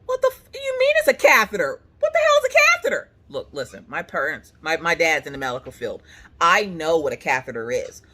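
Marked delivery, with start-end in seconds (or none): 0.0-3.1 high, loud shrieked questions; squealing indignant outburst; outraged disbelief. Retold or imitated. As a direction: fire back in mock horror. Acted outraged reaction to catheter.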